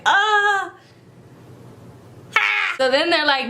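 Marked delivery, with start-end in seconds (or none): 0.0-0.7 startled gasp. Retold or imitated. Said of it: "And she's like".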